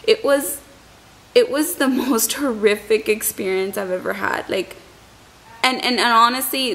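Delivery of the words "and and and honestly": usual speaking voice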